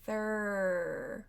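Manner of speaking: Through, TH held with the tongue through the teeth. Shown as a demonstration. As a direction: hold the TH of Through. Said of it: "That T H R sound".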